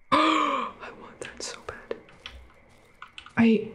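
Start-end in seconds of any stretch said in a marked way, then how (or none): 0.1-2.0 breathless whisper of want; hushed greedy whisper; whispered longing. Real, not acted. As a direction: whisper it like you crave it. Gamer coveting item, whispered.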